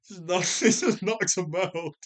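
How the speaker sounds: slow, laughing delivery; cracking up while telling it; real amusement. Real, not acted. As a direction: laugh through the words, drawing them out. Amused by one-punch scene.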